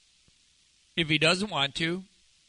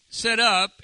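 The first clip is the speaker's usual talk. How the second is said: loud, raised command; commanding, higher and louder; acted command; 6 semitones higher, 7 dB louder than usual. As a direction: call it out as an order to rise. Acting out Jesus' command to rise.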